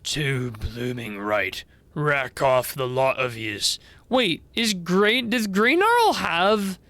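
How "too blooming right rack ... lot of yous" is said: low, slow Australian dragon; Australian accent. Acted. Old dragon sends the visitors off, in Australian accent. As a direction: dismiss them in a gruff Australian accent. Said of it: "an Australian accent?".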